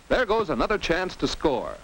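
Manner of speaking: ordinary talk, nothing marked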